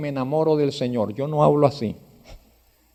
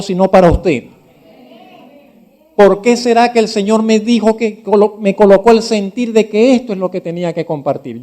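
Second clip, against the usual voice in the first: louder, rising preacher's challenge to the congregation; preaching at full force; 4 semitones higher, 8 dB louder than usual. Acted. Pastor challenging his congregation, preaching loudly. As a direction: press each question on the listeners.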